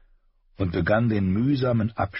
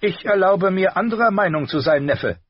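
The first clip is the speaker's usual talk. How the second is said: higher, louder dry retort; Tyrion retorting; 12 semitones higher, 6 dB louder than usual. A narrator's reading. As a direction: cut the nephew down politely. Tyrion answers his nephew.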